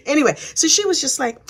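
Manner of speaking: ordinary talk, nothing marked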